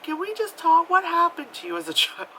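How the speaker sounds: high, put-on pleading voice breaking into laughter; squeaky mock-concerned comic voice; Bill Burr comedy bit. Comic asks about a childhood, mock concern. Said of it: "it's like Bill Burr's comedy thing".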